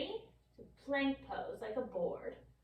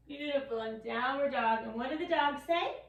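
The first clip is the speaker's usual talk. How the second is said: usual speaking voice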